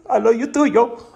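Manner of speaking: high, loud mock-sobbing reply; blubbery high voice in imitation; imitating tearful girlfriend. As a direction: wail it back, hammed-up. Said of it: "So she says".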